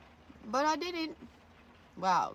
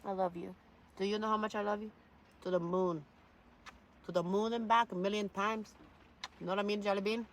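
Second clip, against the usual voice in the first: tender; 3 semitones higher than usual. Real, not acted. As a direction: coo it lovingly. Tender words to pet.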